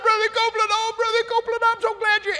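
high falsetto woman calling out; imitating pastor's wife. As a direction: call out in a high put-on woman's voice. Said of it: "she said".

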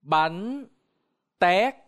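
"Bánh tét" spaced, tét high and loud; Vietnamese pronunciation. Shown as a demonstration. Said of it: "Now repeat".

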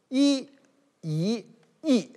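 一 said in three tones, the first one high. Shown as a demonstration. Teacher showing students the three tones of 一. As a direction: demonstrate the three readings of 一 one after another. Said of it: "它有三个音".

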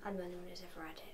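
softly whispered admission; a whispered late-night reply. Real, not acted. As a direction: keep your voice down. Hushed night-time exchange.

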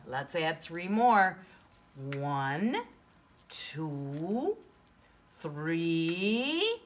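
singsong counting with rising pitch; broad singsong kids theatre. Shown as a demonstration. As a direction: sing the numbers up to the kids.

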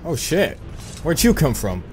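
ordinary talk, nothing marked

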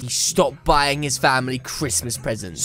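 low, solemn punchline; deadpan cautionary tale ending; mock grave. Grave end of mock story.

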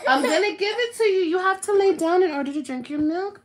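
high, loud coaxing over cries. Real, not acted. Mama making crying baby lie down for milk, high coaxing.

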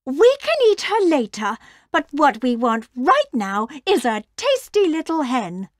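high-pitched wolf-mum voice; high, fussy mother wolf. A narrator's reading. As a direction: pipe up high, wanting a treat.

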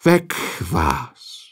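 hushed whisper of a narrator. A narrator's reading. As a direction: breathe it out, stunned. Girl has just been eaten.

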